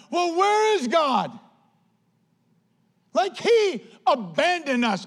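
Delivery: high, loud, slow indignant cry; complaining Gideon. Retold or imitated. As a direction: throw the question at heaven in outrage. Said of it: "Gideon's going".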